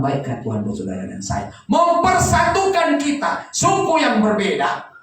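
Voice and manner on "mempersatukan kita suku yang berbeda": high, loud preaching; preaching at full force. Acted. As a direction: proclaim it to the whole church. Preaching again.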